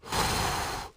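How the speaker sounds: long, breathy sigh; weary sigh. Acted. Narrator voicing a tired traveller on a long walk, weary sigh. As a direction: let out a long exhale.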